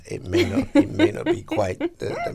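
high squeaky laugh through words; giggling as she says it. Real, not acted. Laughing about niece's message.

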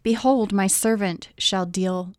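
ordinary delivery

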